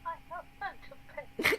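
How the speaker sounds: mock British accent. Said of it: "It's so British".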